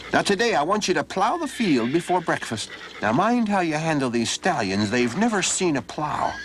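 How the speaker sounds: high, put-on old man's voice giving orders; a crafty old master setting a hard task; gruff old farmer. Old man orders Donald to plow, in a high put-on voice.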